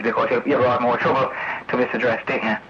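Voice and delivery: ordinary talk, nothing marked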